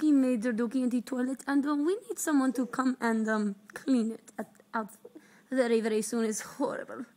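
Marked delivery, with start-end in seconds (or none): none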